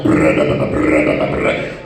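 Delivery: low buzzing brr brr noise; imitated escalator rattle. Comic telling about a drunk on the escalator, low buzzing brr noise. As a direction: mimic the stuck escalator.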